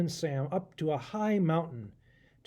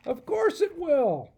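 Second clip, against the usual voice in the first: much higher, louder, slower know-it-all goat; goat professor voice; 10 semitones higher, 6 dB louder, slower than usual. Acted. Goat professor scoffing at Adam, smug goat voice. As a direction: bleat it out like a smug old goat. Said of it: "Over here's the professor. He's a goat".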